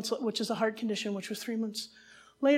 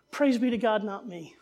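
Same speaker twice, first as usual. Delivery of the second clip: ordinary delivery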